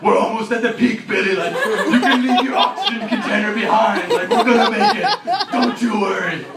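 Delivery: high, loud, hammed-up rallying cries; shouted overdramatic reassurance on the climb; mock Sherpa encouragement. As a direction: urge a climber on towards the summit. Sherpa urging Billy up the stairs.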